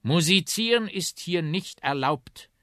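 high, loud, slow rebuke; officious policeman. Acted. Policeman repeats the ban to the instruments, loud slow authority. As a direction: repeat the rule slowly and forcefully.

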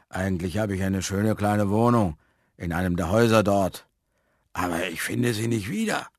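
put-on man's voice, confiding a mishap; resigned fat man. A narrator's reading. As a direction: confess it as the fat vagrant. (dicker Mann, resigniert)

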